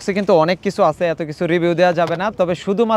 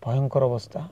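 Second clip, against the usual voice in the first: softer, hushed so the lion stays; hushed awe; 7 semitones lower, 10 dB quieter than usual. Real, not acted. Vlogger beside a lion telling viewers, in a hushed voice. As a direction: keep the voice low and awed near the lion. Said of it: "আমরা এখানে কথা বলা যাবে না".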